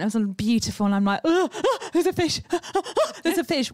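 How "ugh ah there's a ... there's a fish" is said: high, squealing gasps of fright; mock panic. Woman reliving a honeymoon swim among fish, mock panic. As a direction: play up a panicky yelp, half laughing.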